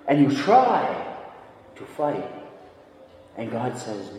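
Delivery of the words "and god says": ordinary talk, nothing marked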